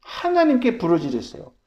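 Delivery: loud, high, pounding sermon; fervent preaching. Acted. Pastor urging the church to cry out, loud fervent preaching.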